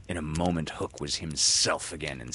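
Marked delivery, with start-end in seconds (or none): none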